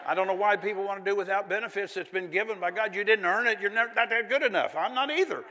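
loud, high, building preacher's crescendo; fiery preaching. Acted. Preaching at full force.

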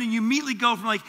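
usual speaking voice

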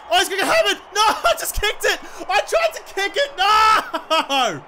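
high, squealed protest breaking into laughter; real laughing despair; laughing dismay. Real, not acted. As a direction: wail helplessly through a laugh. Botched kick, laughing protest.

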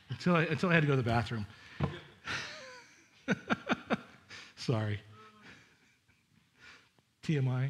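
ordinary delivery

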